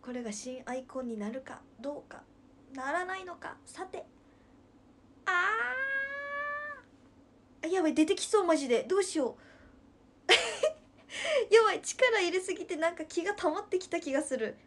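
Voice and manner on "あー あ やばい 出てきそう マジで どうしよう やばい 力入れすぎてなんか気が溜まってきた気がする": 高く大きな声で力む; 必殺技の気を溜めるように力んで; 気を溜める演技. Pretend. カメハメハの気を溜める演技. (大声で力みながら気を溜めるふり)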